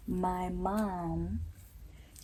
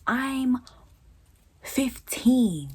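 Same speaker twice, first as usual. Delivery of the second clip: higher and louder, fed up; indignant outburst; 7 semitones higher, 7 dB louder, much slower than usual. Real, not acted. Venting about cooking for family at fifteen, loud indignant. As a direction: snap it out in disbelief.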